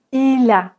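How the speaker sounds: Il a linked smoothly, il-a; liaison. Shown as a demonstration. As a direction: link the L into a. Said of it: "on fait la liaison".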